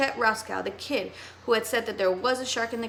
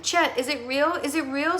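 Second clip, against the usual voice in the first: gasping; 4 semitones higher than usual. Acted. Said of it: "Sid gasped".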